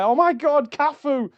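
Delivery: high, loud "Oh my God, Cafu!"; excited gush. Retold or imitated. Fan ready to post his photo, gushing high and loud. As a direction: squeal at the star you met.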